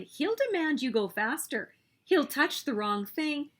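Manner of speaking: plain reading voice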